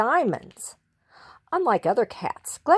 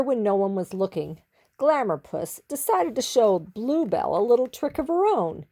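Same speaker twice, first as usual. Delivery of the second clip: usual speaking voice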